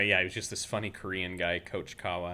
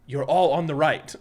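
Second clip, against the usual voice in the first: put-on higher and louder Korean-accented punchline; teacher teases the class in a Korean accent; Coach Kawa's Korean accent; 7 semitones higher, 10 dB louder than usual. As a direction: deliver the joke answer in a Korean accent. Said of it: "doing an impression of Coach Kawa exactly".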